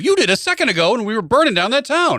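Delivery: indignant village elder, played up; mock scolding elder. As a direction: scold them like an affronted chieftain. Acted Viking chief scolding.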